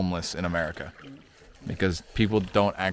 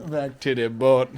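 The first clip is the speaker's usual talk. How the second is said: higher, louder mock Jamaican patois; Caribbean accent; 4 semitones higher, 7 dB louder than usual. Repeating the boat joke through giggles, mock Caribbean accent. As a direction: punch it out in a playful Caribbean accent. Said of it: "That's a great accent".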